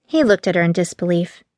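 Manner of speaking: ordinary delivery, nothing marked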